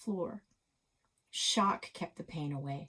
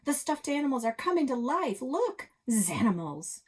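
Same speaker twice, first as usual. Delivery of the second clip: higher, louder frightened yell; 8 semitones higher, 5 dB louder than usual. Acted. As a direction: shout the warning, pointing in alarm. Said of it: "Chase pointed and yelled".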